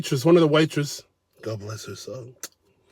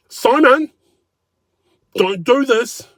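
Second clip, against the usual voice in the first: much higher, louder plea; much higher anxious plea from the wife; pleading wife; 11 semitones higher, 5 dB louder, slower than usual. Retold or imitated. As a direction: beg your husband to stop.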